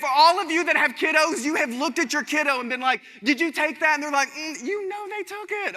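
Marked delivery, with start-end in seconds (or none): 3.2-4.0 high, louder, accusing parent question; suspicious parent. Retold or imitated. Parent to kiddo, asking who took it, high accusing. 4.3-5.8 put-on much higher, softer and slow, sheepish little-kid voice; guilty kid. Kid answering parent after being caught, high sheepish voice.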